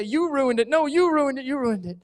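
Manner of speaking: high, loud squabbling yells; kids screaming. Acted. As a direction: shout each other down. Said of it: "arguing and screaming".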